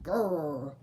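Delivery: low rumbling bear growl; growling at being told off about the nuts. Acted. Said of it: "growled the old bear".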